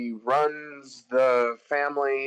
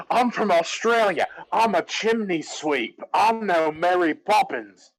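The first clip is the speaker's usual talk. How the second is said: higher, hammed-up Australian chimney sweep; bad Australian accent; 5 semitones higher than usual. Bert introducing himself as a chimney sweep, bad Australian accent. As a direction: put on a silly fake Australian accent. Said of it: "what the hell was this accent for".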